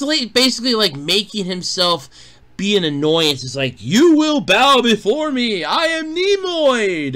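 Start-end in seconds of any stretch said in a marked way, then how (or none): 3.8-7.1 mock-grandiose proclamation, drawn out and rising higher; pompous villain impression. Imitating Rick's arch nemesis demanding respect, mock pompous. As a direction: declaim it like a hammed-up ocean overlord.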